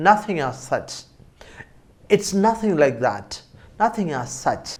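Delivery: ordinary delivery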